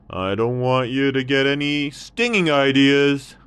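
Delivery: a thirsty camel perking up; hint of a thirsty camel. Camel voice, louder.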